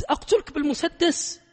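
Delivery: high, loud mock threat to a student. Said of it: "قلت". (تهديد ساخر بصوت عال ومرتفع)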